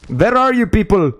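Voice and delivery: lightly put-on vampire accent, "Vhere are you people". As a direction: hunt them like a mock Dracula, light vampire accent. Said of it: "I want to suck your blood".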